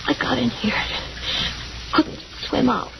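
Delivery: breathless, exhausted whisper; faint whisper. Acted. Exhausted wife about to faint.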